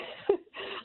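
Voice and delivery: a hushed, breathy sob caught in the throat; real tears breaking through as she holds them back; choked-back sob. Real, not acted. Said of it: "sorry, I'm trying not to cry".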